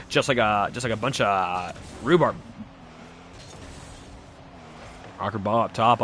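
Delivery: brisk live esports call; play-by-play. Acted. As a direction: call the match as it happens.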